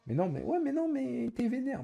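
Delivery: high mock whine imitating a viewer; imitating a chatter saying he's angry; mocking imitation. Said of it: "Je t'ai juste dit".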